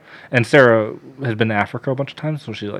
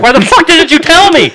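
high, loud accusing yell; retold furious outburst; 9 dB louder than usual. Slapping Keith after the HIV news, retold furious outburst.

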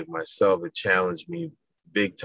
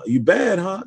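taunting officer; 12 semitones higher than usual. Retold or imitated. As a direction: needle the recruit, pitched up. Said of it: "And he said".

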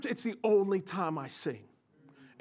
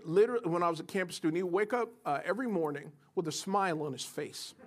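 usual speaking voice

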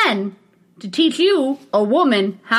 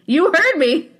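normal talking voice

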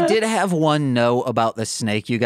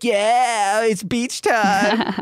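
excited beach cheer; 8 semitones higher, much slower than usual. Retold or imitated. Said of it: "And they're like".

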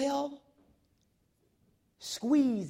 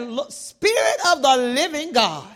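much louder, a booming call to God; loud prayer; 2 semitones higher, 11 dB louder than usual. Retold or imitated. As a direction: cry it out to the whole room. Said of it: "And y'all know I'm loud".

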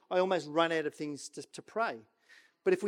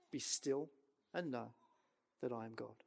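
hushed; 7 semitones lower, 13 dB quieter than usual. Shown as a demonstration. Preacher softly demonstrating stillness, hushed. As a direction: drop to a near hush to show stillness. Quiet demo of still prayer.

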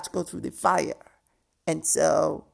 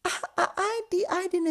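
high wailing no; mock wail. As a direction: cry out no in a high pitch. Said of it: "And I was like".

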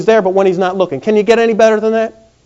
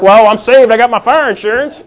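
mock self-satisfied believer, higher and louder; hammed-up smug believer, higher and louder; smug churchgoer; 4 semitones higher, 4 dB louder than usual. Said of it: "we're like".

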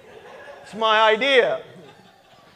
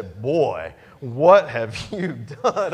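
much lower, slow incredulous scold; an old farmer, slow and aghast; old farmer Benny; 6 semitones lower, 4 dB louder, much slower than usual. Retold or imitated. Old deacon asks the pastor what he has done, slow low scold. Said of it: "as only Benny Ray could say".

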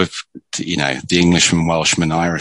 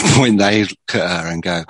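ordinary delivery

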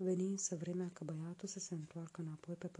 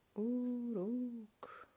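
higher, long drawn-out haunting call; the wind calling his name from the sea; eerie call from afar; distant call; 6 semitones higher than usual. A narrator's reading. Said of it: "Își auzea numele strigat".

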